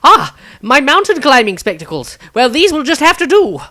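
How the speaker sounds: high, loud delighted exclamation; eccentric wizard exclaiming. Acted. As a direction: cheerfully exclaim to no one in particular.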